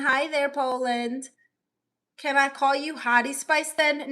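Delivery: ordinary talk, nothing marked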